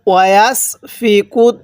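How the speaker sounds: ওয়াসফিকু recited slowly, drawn out; slow recitation. Shown as a demonstration. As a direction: chant it slowly for students to repeat. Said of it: "এরপর বলুন".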